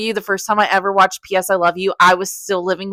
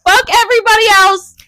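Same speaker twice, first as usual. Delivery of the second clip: high, louder delighted shout; excited outburst; 11 semitones higher, 5 dB louder than usual. Real, not acted. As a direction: yell it out, thrilled for the couple. Reader cheering the hero.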